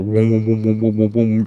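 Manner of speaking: pulsing video game warble; repeated wobbling "whoom" noises; eight-bit whooming. Retold or imitated. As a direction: imitate a crazy eight-bit sound. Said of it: "this crazy, like, fucking, like, eight-bit".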